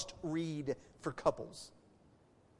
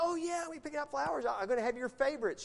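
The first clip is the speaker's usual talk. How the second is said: eager husband; 6 semitones higher, faster than usual. Retold or imitated. Husband boasting to wife in anecdote.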